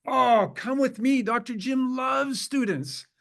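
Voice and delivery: high, drawn-out gushing invitation; delighted welcome. Retold or imitated. Doctor takes a student to meet Doctor Jim, delighted welcome.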